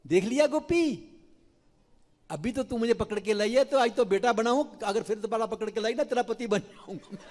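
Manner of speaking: high, loud mischievous taunt; cheeky Krishna. Acted. Krishna taunts the gopi after her scolding, high cheeky teasing. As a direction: tease the gopi with a grin. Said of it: "Kanha बोलते हैं".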